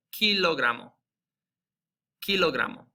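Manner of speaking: "kilogramo" starting on a clear hard K. Shown as a demonstration. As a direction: hit the K of "kilogramo". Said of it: "pronounced just like the K".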